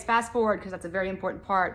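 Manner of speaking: normal talking voice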